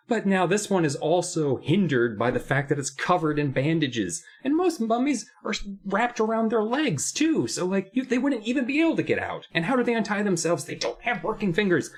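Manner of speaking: worked-up, high-pitched exasperation; upset rant. Real, not acted. Book reviewer ranting about mummies as monsters, upset. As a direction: let the pitch climb as you vent. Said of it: "I can see you're upset".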